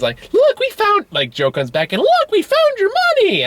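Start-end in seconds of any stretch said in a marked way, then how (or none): 0.3-1.1 very high, squeaky cartoon child; excited falsetto little-girl squeal; Mickey Mouse. Little girl shows Joe the money, Mickey Mouse voice. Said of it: "doing my best Mickey Mouse impression". 2.0-3.4 very high, squeaky cartoon child; excited falsetto little-girl squeal; Mickey Mouse. Little girl shows Joe his money, Mickey Mouse voice. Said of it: "doing my best Mickey Mouse impression".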